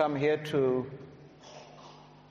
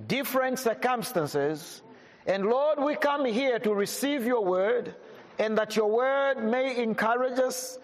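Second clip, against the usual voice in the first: raised, earnest prayer voice; 5 semitones higher than usual. Acted. Preacher praying for the congregation before the word, earnest. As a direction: pray over the gathering.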